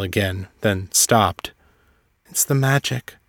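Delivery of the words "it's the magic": usual speaking voice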